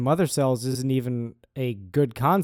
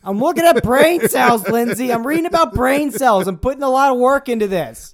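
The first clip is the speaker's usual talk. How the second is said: acted husband; 9 semitones higher, 7 dB louder than usual. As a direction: protest like a caught-out husband. Comic skit between a couple.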